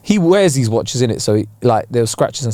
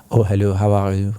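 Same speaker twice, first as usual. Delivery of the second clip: lightly imitated French accent, lower pitch; 5 semitones lower than usual. Watchmaker's saleswoman greets two visitors, imitated French accent. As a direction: greet customers with a low, mild French accent. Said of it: "she had a French accent".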